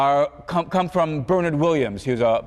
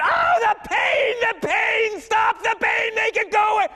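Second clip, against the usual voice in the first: mock cry of pain. Professor acting the tortured Shelley Kagan, hammed-up high pained shouting. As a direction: shout it like a lecturer playing a man in agony. Imagined torture victim crying out.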